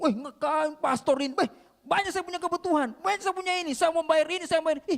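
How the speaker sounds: loud, high, annoyed outburst; retold loud protest about bills; angry complaint. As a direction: mimic the debtor snapping back. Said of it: "Ih, dia marah-marah".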